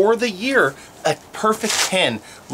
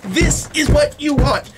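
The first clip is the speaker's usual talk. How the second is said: excited; 8 semitones higher, much slower than usual. Real, not acted. Stomping to show roof strength.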